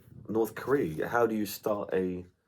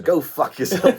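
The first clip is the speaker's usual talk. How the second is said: raised, higher, defiant shout; loud defiant retort; 7 semitones higher, 7 dB louder, slower than usual. Retold or imitated. Soldier defies the Russian ship: loud retort. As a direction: hurl it back over the radio, louder. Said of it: "turns up the volume loud and says".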